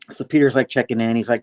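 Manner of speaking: usual speaking voice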